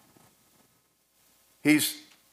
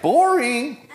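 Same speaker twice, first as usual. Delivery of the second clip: mock boredom; 10 semitones higher, 6 dB louder than usual. Mocking sitting on a cloud.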